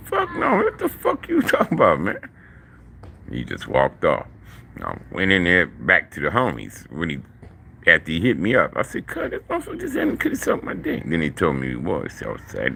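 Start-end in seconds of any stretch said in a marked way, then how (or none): 0.1-2.2 much higher pitched, indignant outburst; shocked refusal. Retold or imitated. Turning down the big man, a high shocked outburst. 9.1-11.0 rushed, much higher voice, reliving the shock; shocked retelling. Retold or imitated. Telling the homies about the big man's proposition, shocked and high.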